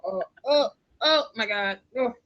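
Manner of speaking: ordinary talk, nothing marked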